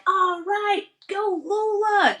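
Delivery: cheering coach. Retold or imitated. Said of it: "they'd be like".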